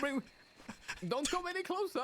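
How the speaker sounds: very high cockney warning; cockney robber warning. Acted. A robber warning hostages in a high cockney voice. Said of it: "Oh, that was good".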